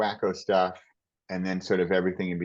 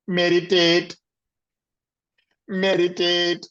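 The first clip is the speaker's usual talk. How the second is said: Indian accent mocking, pitched higher and louder; 9 semitones higher, 5 dB louder, slower than usual. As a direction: parody Deepak's Indian accent on meditate. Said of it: "makes fun of Deepak's Indian accent".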